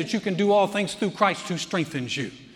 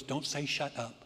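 hushed, low mock reproof; Joyce's quiet reproof; 5 semitones lower, 11 dB quieter than usual. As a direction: imitate a soft little telling-off. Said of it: "I'm now in trouble with Joyce, who goes".

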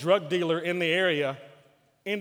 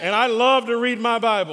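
triumphant; 5 semitones higher, 8 dB louder than usual. Acted. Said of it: "Let's say it one more time".

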